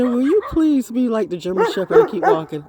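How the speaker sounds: half-sung plea; loud pleading to dog. Retold or imitated. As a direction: beg the little dog to keep walking. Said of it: "Mommy said".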